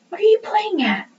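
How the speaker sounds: a high, impatient little ghost voice. A narrator's reading. Liza impatient with Bod at the door, sharp. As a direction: hurry him up, annoyed. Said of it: "Lisa said impatiently".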